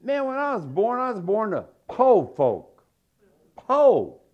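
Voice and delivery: "po" said long and loud for poor, pitched high; Southern drawl "po". Real, not acted. Preacher telling the crowd he was born poor, loud drawled "po". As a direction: stretch "po" in a country drawl. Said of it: "You guys don't even understand".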